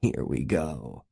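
low, slow, soft and amused; snickering. A narrator's reading. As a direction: say it quietly with a sly snicker. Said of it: "Gates snickered".

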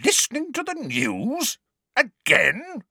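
very high, incredulous outrage; high-pitched indignant disbelief; outraged echo. A narrator's reading. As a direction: throw it back as a scandalised question. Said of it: "exchanged looks of outrage".

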